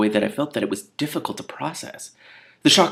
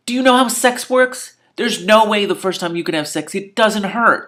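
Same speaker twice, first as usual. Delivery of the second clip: much higher, louder exasperated shriek; 11 semitones higher, 7 dB louder than usual. Real, not acted. Reader ranting at Bella, in an exasperated high shriek.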